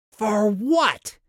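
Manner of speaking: very high-pitched exasperated cry; frantic yell. A narrator's reading. Walker demanding an answer from Vicus, high frantic yell. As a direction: cry out in shrieking disbelief.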